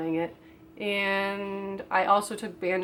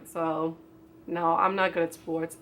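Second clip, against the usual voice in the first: ordinary delivery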